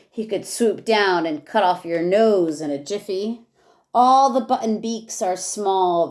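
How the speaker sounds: lively rhyming read-aloud for a class; reading to children. Acted. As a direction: read each verse with bounce for the kids. Teacher reads rhyming picture book aloud.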